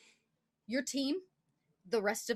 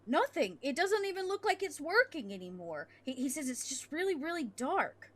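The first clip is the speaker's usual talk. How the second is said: lightly higher soldier voice; soldier's report; 7 semitones higher than usual. Acted. A hint of the soldier answering his squad, raised. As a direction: report back what you see, a bit higher.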